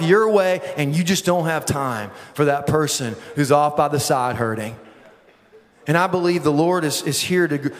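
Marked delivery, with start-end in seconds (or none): none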